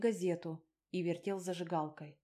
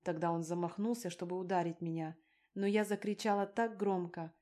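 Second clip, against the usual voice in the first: usual speaking voice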